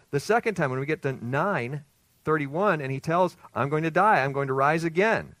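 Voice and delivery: ringing pulpit delivery; fervent preaching. Acted. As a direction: drive the point home to the congregation. Preacher at full force.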